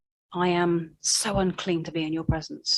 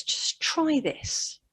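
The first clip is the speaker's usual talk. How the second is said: a hushed, slow, high invitation; 8 semitones higher, much slower than usual. Pretend. Jesus gently inviting the doubting fishermen, slow and hushed. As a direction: breathe it out gently, slowly.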